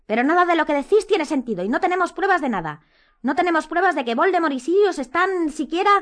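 high, loud, exasperated objection; shouting. A narrator's reading. Hermione objects to the boys' theory, shouting. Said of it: "gritó Hermione".

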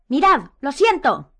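slow, loud, high cry; shouted interjection. A narrator's reading. Hermione breaks into the boys' theory, shouting. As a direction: cut in loudly, spacing the words. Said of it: "gritó Hermione".

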